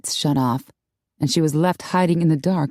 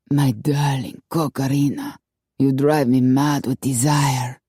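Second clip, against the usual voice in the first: put-on murmured in a lower Latin-accented lover's voice; a hammy Latin lover's purr; 3 semitones lower than usual. A narrator's reading. Don Orlando wooing Corky in a low Latin-accented murmur. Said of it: "murmured with a Latin accent".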